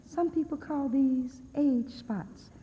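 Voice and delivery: put-on a little old lady in a commercial, slow and sweet; slow, sugary old-lady ad voice; mimicking sweet old lady.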